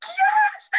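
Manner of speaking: a high, loud, overjoyed shout; shrieking yes to a long-lost sister; excited yes. Retold or imitated. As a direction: shout it with pure joy at meeting her. Recognizing a fellow Chuukese woman.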